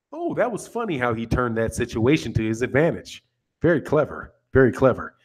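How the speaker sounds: low, soft, restrained appreciation; mock-reserved British approval; dry wit. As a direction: react drily, holding the laugh back. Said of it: "it's dry wit. Very dry".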